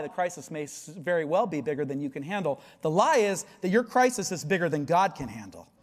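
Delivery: usual speaking voice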